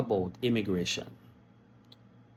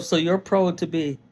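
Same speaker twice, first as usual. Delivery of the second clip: high, louder incredulous inner voice; raised, half-laughing disbelief; mock disbelief; 7 semitones higher, 4 dB louder than usual. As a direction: act out laughing amazement at the boast. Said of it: "I was saying to myself".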